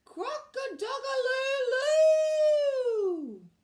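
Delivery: shouted high, loud, very slow crow; a rabbit straining to crow like a rooster; rabbit's botched crow. A narrator's reading. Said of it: "threw his head back and shouted".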